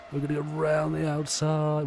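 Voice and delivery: excited overtaking call. Real, not acted. Racer calling his move round the outside, excited. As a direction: call the move in a high, sustained voice.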